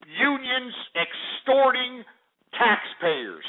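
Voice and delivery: slow, high, stretched-out words; drawn-out emphasis. Real, not acted. Host accuses unions before listeners, drawing words out. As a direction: stretch "unions extorting" out for force. Hammers his charge against unions.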